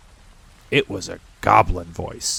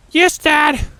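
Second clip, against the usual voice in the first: put-on young goblin son; 5 dB louder than usual. Goblin son Helfer answers.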